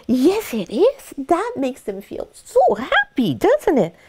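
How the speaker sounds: high, slow sing-song cooing; baby talk. Shown as a demonstration. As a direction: coo to the puppy in baby talk. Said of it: "baby talk to your puppy".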